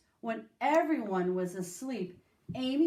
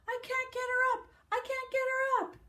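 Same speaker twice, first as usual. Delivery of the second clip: high, fast, fretful mother voice; panicky mother; faster than usual. A narrator's reading. Mother running downstairs to tell the family, high and fast. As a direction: rattle it off in a flustered panic.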